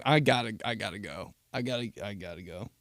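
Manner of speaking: usual speaking voice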